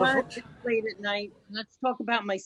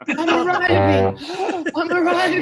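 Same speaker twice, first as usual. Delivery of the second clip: mock English climax; 9 dB louder than usual. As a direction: play the stiff husband arriving. Husband's climax, mocked.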